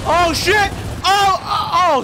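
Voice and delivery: high, loud scared yelp fading to relief; startled shriek. Real, not acted. Gamer ambushed on screen, shrieking in fright. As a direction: jump out of your skin.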